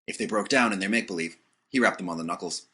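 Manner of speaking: plain reading voice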